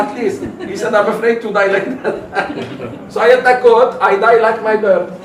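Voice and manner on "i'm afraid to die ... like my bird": louder, laughing through the words; laughing confession. Retold or imitated. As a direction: confess your fear while cracking up. Sheikh explains his fear, laughing.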